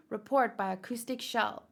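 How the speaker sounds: usual speaking voice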